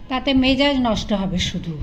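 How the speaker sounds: ordinary delivery, nothing marked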